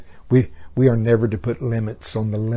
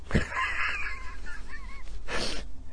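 high squealing laugh; high squeal of laughter; 10 dB quieter than usual. Real, not acted. Laughs mid-quote.

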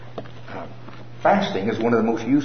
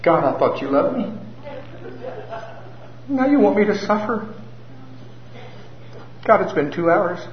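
higher, whiny self-pity; hammed-up pitiful complaint to God; pitiful little voice; 8 semitones higher than usual. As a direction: whimper like a spoiled child. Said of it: "this little pitiful voice begins to say".

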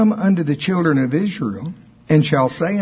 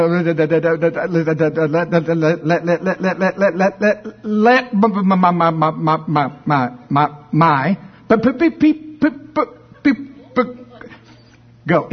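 stuck on let, my and but, rising to a squeaky high; mock stutter, pitch climbing as he gets stuck; stuttering Moses; 4 semitones higher than usual. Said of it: "Moses stuttered".

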